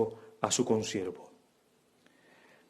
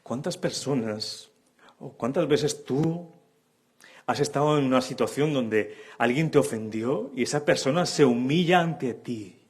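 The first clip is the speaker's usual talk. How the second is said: normal talking voice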